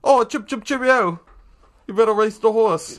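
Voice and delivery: high, loud mock-English gangster; English mobster accent. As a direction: put on a cartoonish English accent. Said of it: "someone from the English Mafia".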